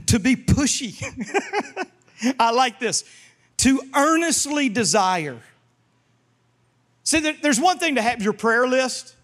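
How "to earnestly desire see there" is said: usual speaking voice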